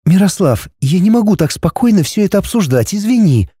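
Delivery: put-on embarrassed young woman. A narrator's reading. Linda embarrassed reply.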